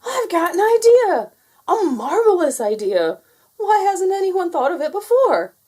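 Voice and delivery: very high, loud eureka shout; father bursting with a brilliant idea; excited shout. Acted. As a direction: shout with sudden inspiration. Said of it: "Father Rabbit shouted".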